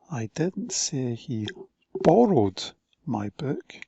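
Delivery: slow, "borrowed" emphasized; stress on borrowed. Shown as a demonstration. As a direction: lean on "borrowed". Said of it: "different emphasis on different words".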